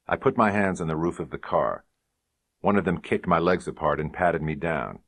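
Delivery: plain reading voice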